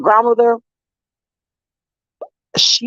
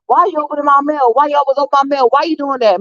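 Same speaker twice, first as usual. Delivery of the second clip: higher-pitched retold protest; indignant retort; 7 semitones higher, faster than usual. Daughter confronting mom over opened mail, indignant. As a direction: replay an indignant challenge. Retold complaint to her mother.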